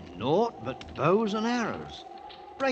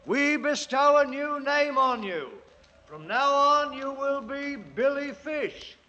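bestow the name like a king; mock-regal decree; royal proclamation; 8 semitones higher than usual. Naming the priest like a king.

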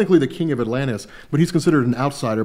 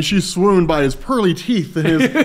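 high, slow, dreamy swooning; mock adoration; 10 semitones higher, 4 dB louder, slower than usual. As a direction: gush over him like a fangirl. Swooning description of Aquaman.

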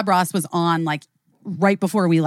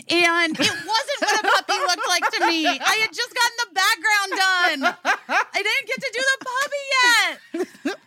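high-pitched squeal of protest; mock indignant protest; 13 semitones higher than usual. Grown woman protests her preschool humiliation, squealing mock outrage. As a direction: wail it comically high, on the edge of laughter.

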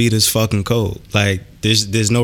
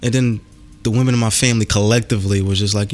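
normal talking voice

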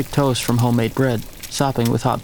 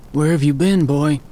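put-on croaking old man; 3 semitones higher than usual. A narrator's reading. The old man croaks at the boy returning late. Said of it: "his voice croaked".

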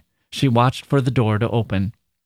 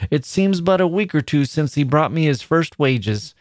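much higher, monotonous reminiscing grandmother; musing old woman; 6 semitones higher than usual. A narrator's reading. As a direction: ramble fondly about her son. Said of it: "continued to muse aloud".

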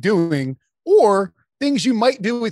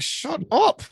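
mock telling-off, higher pitch; imitated scolding; 8 semitones higher, 3 dB quieter than usual. Entourage to Kenneth, mock shushing. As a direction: snap it higher, lightly acted. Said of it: "They're like".

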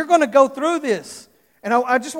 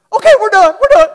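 put-on high, louder eager little-boy voice; relieved child; 10 semitones higher, 14 dB louder than usual. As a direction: jump at the way out like the son. Relieved son answering dad.

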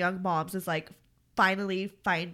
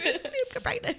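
laughing; 6 dB quieter, faster than usual. Real, not acted. Joking while laughing hard.

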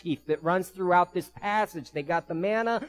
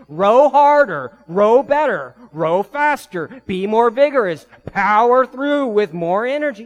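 loud, higher barking commands; mock a drill coach shouting at rowers; imitated yelling; 7 semitones higher, 12 dB louder than usual. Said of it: "He's not yelling at you saying".

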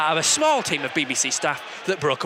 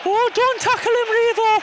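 excited shout; 5 dB louder than usual. Real, not acted. Reacting to the match.

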